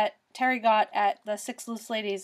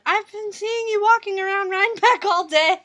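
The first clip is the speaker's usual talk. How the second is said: high, loud gushing imitation; mock-excited, raised-pitch quote; imitating excited stranger; 8 semitones higher, 7 dB louder than usual. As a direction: gush it like an eager fan.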